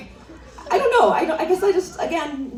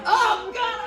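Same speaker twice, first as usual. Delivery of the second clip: shocked gasp; 12 semitones higher than usual. Retold or imitated. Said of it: "She was like".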